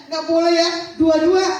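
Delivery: very loud, firm insistence; emphatic correction. Real, not acted. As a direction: call it out forcefully to the room. Preacher insisting on both parents.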